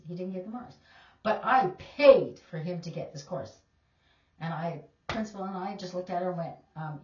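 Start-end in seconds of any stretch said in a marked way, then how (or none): 1.2-3.5 loud mock-outraged protest; imitating an affronted parent's complaint; indignant parent. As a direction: play the entitled parent, loud. Parent arguing over failing grade.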